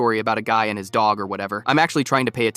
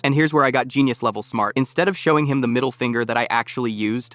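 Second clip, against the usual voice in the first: usual speaking voice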